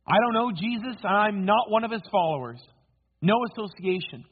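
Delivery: emphatic denial. Acted. Peter denies knowing Jesus to his accusers, emphatically.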